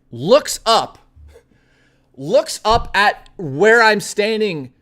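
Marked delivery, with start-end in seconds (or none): none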